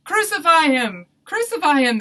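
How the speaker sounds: high, very loud chant-like shout; crowd shouting. A narrator's reading. Crowd answers Pilate's question, shouting. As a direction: yell it like an angry mob. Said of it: "they shouted".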